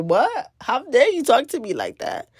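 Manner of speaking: how dare you, with attitude; indignant attitude. Retold or imitated. Woman retelling snapping back at a stranger, indignant. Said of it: "in an attitude where I was like".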